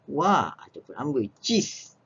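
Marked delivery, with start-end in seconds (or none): none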